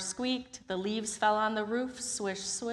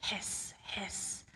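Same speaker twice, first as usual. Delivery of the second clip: soft kettle hiss; 9 dB quieter than usual. Acted. Kettle sound effect.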